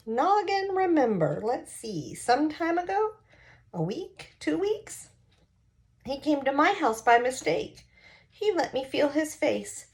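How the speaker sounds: put-on high, playful old woman voice; a forgetful old lady, childlike and teasing; mischievous old lady. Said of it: "she looked like a mischievous child".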